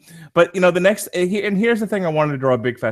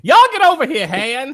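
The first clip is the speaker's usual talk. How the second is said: much higher, loud, hammed-up mock Leia; 5 dB louder than usual. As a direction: yell over at Han in a cartoonish mock voice. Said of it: "she was like".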